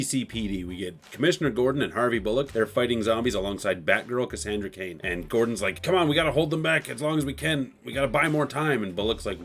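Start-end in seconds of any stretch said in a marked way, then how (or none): 5.8-8.8 higher, pressing plea; acted battle urgency; urgent rallying. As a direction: urge the fighters on, lightly acted. Acted officer urging on fighters.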